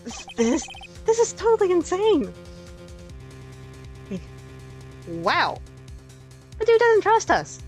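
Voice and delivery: almost yell it, appalled; outraged disbelief. Real, not acted. Outraged at twist.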